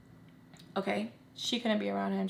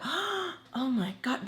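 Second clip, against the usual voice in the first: mock gasp, then a higher, drawn-out "oh my God"; imitated shocked reaction; 5 semitones higher, 3 dB quieter, much slower than usual. Relatives reacting to stories about other children, mock shock. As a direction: act out a scandalised listener, slowly. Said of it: "they would have this reaction".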